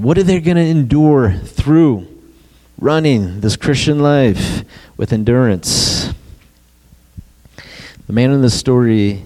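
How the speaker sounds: ordinary delivery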